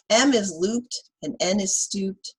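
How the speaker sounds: put-on lively read-aloud for kids; reading to children. Teacher reading Chicka Chicka Boom Boom to children, lively. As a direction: read the rhyme to the class with bounce.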